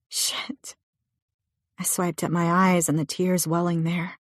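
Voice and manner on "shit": whisper. Acted. Hopeless curse in the car.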